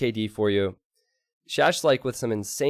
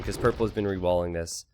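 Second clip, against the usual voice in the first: ordinary talk, nothing marked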